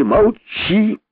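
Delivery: sharp high shout. A narrator's reading. King silencing the rabbit, shouting. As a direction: cut him off with a yell. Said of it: "крикнул король".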